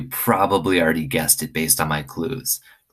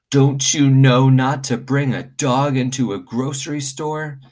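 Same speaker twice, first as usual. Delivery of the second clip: a hint of a stern manager, a bit higher; scolding manager; 9 semitones higher, 4 dB louder than usual. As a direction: scold the girl lightly. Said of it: "The manager said".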